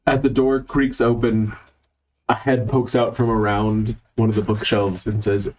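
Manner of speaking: ordinary talk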